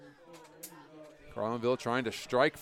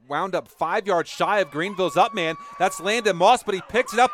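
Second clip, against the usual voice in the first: raised, loud call of a breakaway return; excited play-by-play; 6 semitones higher, 8 dB louder than usual. Real, not acted. Announcer calls a kickoff return across midfield, excited play-by-play. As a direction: ride the runner upfield with rising urgency.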